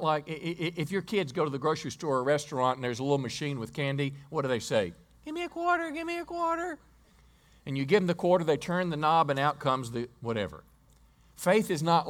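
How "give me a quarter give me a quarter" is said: put-on much higher, whiny kid voice pleading; begging child. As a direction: nag like a kid at the candy machine. Kids begging at candy machine.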